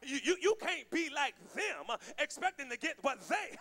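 preacher building in force; fervent preaching. Real, not acted. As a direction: drive the contrast home to the congregation. Preacher drives the point home.

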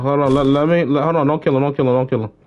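ordinary talk

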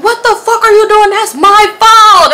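high, loud imitation of an angry friend; hammed-up furious shout; outraged yell. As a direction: yell it in mock outrage, stretching the end. Acts friend yelling about phone.

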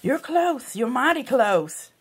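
usual speaking voice

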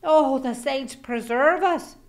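high, loud Irish whine; whining Jamie. A narrator's reading. Lazy husband whining to his wife, high and loud. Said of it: "Jamie would whine".

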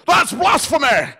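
high, slow, loud accusing shout; hammed-up public denouncing; mock outburst. Church member standing up in the group, mock loud accusing shout. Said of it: "Lamb stands up and goes".

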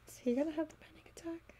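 quick hushed aside; whispering. Real, not acted. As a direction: whisper it fast. Aside while partner reads.

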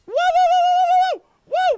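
very high, loud string of cries; panicked whooping. Real, not acted. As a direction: shriek a run of whoas in panic. Panics at game monster.

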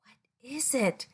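put-on curious whisper to kids. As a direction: whisper it like a secret.

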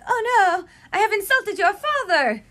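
high, loud mock horror; mock French Canadian dismay. Daniel apologising to Kate, hammed-up dismay. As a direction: ham up the gallant apology. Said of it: "He's like".

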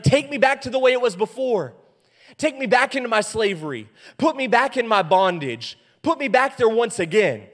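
loud, high mock plea; mock pleading. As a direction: cry out to go back, hammed up. Mock plea to return to slavery.

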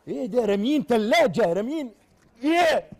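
loud, high disbelieving cries; hammed-up amazed shouts; comic shock. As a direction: yelp at the sight of a thrown fridge. Sweeper shocked by thrown fridge.

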